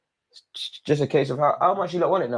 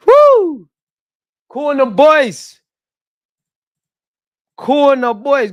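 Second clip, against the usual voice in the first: high, loud whoop then drawn-out cheer; joyful whoop; 12 semitones higher, 6 dB louder, much slower than usual. Real, not acted. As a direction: celebrate loud and stretched. Another celebration.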